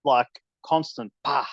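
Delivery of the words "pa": PA popped out sharply; strong plosive "pa", quick and sharp; short burst of air. Shown as a demonstration. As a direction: release the p of "pa" in one short burst. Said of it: "have this burst of air".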